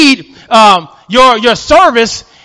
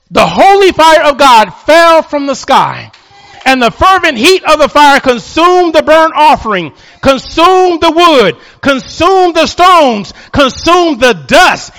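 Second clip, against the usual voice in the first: climbing higher and slower to a shout; building preaching; 5 semitones higher than usual. Acted. Preacher to church, building to a shouted climax. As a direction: preach the fire down, slower each line.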